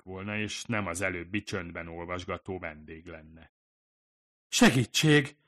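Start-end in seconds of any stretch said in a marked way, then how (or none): 4.5-5.4 loud, high desperate shout, slowing at the end; crying for help. Acted. Fumiko calls to the kitchen for help, desperate shout. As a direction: call out to the kitchen in panic. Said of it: "kiáltott ki a konyhába".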